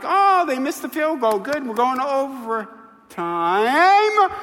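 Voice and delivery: high-pitched relief, stretching the last word; acted crowd reaction. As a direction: play the hopeful fan, dragging out "overtime". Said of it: "watch the reaction like".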